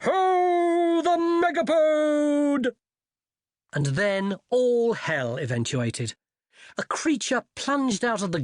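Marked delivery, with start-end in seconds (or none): 0.0-2.8 very high, drawn-out hunting call; hunting cry. Acted. Wizard in the distance calling the hunt, high and drawn out. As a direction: hollo it slowly across the corridors. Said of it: "followed by a cry of".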